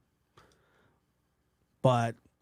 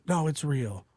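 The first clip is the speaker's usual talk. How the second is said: normal talking voice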